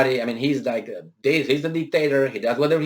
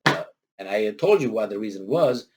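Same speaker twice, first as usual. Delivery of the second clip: usual speaking voice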